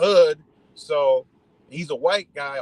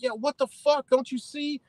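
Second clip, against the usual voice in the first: retold cop scolding; 10 semitones higher than usual. Cop to driver cutting through the crash, exasperated. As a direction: play an exasperated cop, pitched way up.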